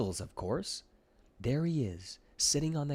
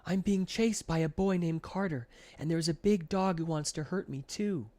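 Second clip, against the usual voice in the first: high, loud rush of panic; blurted out; 7 semitones higher, 4 dB louder than usual. A narrator's reading. Milton blurting to the ice cream lady, panicked and high. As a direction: blurt it all out in a frightened rush. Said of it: "Milton blurts out".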